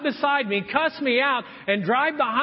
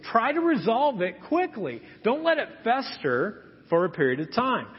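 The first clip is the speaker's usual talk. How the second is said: ordinary talk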